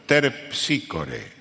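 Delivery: "Terpsícore" said very slowly, every sound spelled out, low; careful model pronunciation. Shown as a demonstration. Teacher shows the student how to say "Terpsícore", slow and careful. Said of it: "Fíjese cómo lo pronuncio yo".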